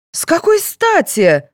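high, slow, affronted challenge; indignant. A narrator's reading. Mother objecting to a search, indignant. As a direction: push back in high-pitched outrage. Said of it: "возмутилась Григорьева".

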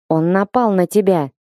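usual speaking voice

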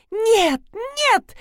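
very high-pitched alarmed cry; acted high shriek of protest; panicked yell. A narrator's reading. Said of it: "заорала Рина".

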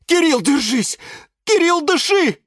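high, loud urging; crying out. A narrator's reading. Plants urging the fainted cactus to hold on, crying out. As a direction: yell encouragement in a panic. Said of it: "закричали сразу все".

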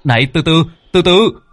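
high, loud call to stop; shouting out. A narrator's reading. Cậu Tẩy hô to gọi thiên ma quản ngục dừng lại, tiếng gọi lớn. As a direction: yell after them to wait. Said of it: "mồm hô to".

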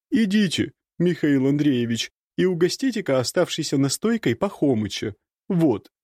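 put-on high bossy servant girl; bossy maid. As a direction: order him about briskly. Marusya voiced. (ворчливая служанка)

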